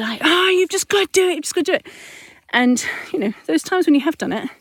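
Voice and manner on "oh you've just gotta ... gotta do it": higher, half-hearted imitation of a frustrated parent; mild mock yell; mock shout. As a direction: act out the urge to yell at your kid. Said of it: "to shout and just to".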